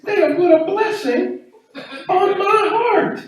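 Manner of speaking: high, breathless mock rapture; touched gushing. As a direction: gush like you've been blessed. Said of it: "I said".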